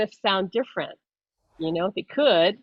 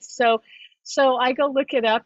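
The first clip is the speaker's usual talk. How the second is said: ordinary talk, nothing marked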